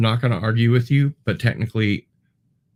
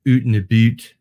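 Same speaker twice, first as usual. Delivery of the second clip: "oot and a boot" said in a jokey Canadian accent, with "oot" rhyming with boot and louder; mock Canadian accent; 2 semitones higher, 7 dB louder than usual. Shown as a demonstration. Host to chat, teasing Canadians with a mock Canadian "oot and a boot". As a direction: lean into the Canadian "oot" sound for a laugh. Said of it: "until they say a boot or oot".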